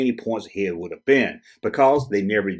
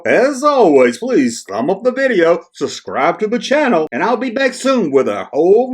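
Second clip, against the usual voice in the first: hammed-up British accent, higher and louder; stupid British accent; 7 semitones higher, 5 dB louder than usual. YouTuber asking viewers to subscribe in a silly British accent. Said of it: "let me do that stupid accent".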